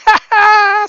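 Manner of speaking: loud, high mock cackle; shrieked gloating ha-ha; mocking laugh. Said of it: "And she goes".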